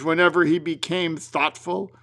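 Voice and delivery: plain reading voice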